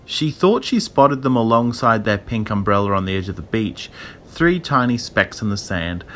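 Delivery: ordinary delivery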